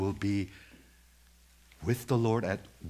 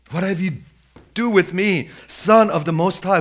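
high, loud demon's cry; acted outcry to Jesus; 9 semitones higher, 12 dB louder than usual. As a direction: call it out forcefully in short phrases. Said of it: "he cries out with a loud voice".

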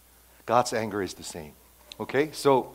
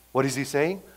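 normal talking voice

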